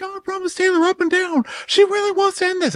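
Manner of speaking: very high mock whine; mocking Shep. Shep promising the women, very high mock whine. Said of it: "So Shep's like".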